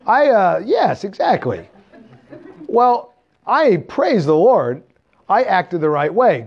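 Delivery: usual speaking voice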